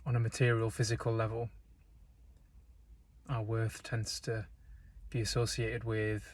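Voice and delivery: calm, unhurried meditation voice with long pauses; guided meditation. Real, not acted. Guide leading listeners into breath and stillness, calm meditation delivery. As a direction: guide them gently, leaving space between phrases.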